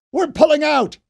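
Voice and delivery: high, loud commanding shout; yell. A narrator's reading. Fisher orders the retreat to everyone around, loud yell. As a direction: call the retreat over the noise. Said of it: "he yelled".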